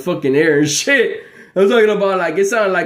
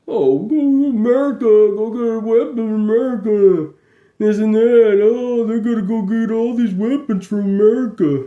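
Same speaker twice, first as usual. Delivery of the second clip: higher, put-on mimicry of the others; mocking whiny voice; 4 semitones higher than usual. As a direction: sneer as you parrot them. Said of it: "They're all like".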